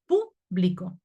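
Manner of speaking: "público" broken up, PÚ, then blico; stressed first syllable. Shown as a demonstration. Teacher showing where the tilde falls, "público" stressed on PÚ. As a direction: punch PÚ in "público" and split off the rest. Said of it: "lleva tilde en la U".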